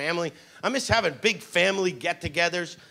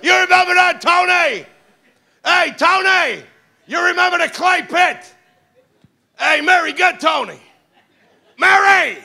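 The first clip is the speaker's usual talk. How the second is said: loud, higher shouting uncle calling across the house; Uncle Cheesy; 6 semitones higher, 4 dB louder than usual. Retold or imitated. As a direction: holler like a rowdy mob uncle. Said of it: "sit with your Uncle Cheesy".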